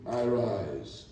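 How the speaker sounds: usual speaking voice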